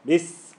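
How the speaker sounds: "Bis" with a long hissing s; stressed s. Shown as a demonstration. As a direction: hit the s at the end of "Bis". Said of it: "Hit the scene".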